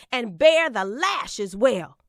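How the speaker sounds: ordinary delivery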